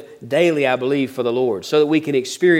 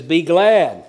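"Be glad" pushed out louder; stress on glad; 2 semitones higher, 4 dB louder than usual. Shown as a demonstration. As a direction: lean on "glad" with a firm, louder push. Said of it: "Notice that, what he says".

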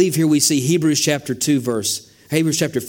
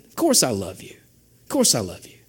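reassuring; 4 semitones lower, 4 dB quieter than usual. Retold or imitated. Father reassuring doubting child.

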